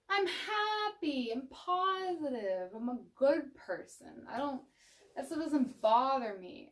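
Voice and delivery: high, slow, loud sing-song denial; an over-bright fake happy voice; forced cheerfulness. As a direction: sell it like nothing could ever bother you. Mocking the falsely happy person.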